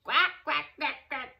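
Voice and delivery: quacking laugh. A narrator's reading. Duck laughing at the cow, quacking. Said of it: "laughed the duck".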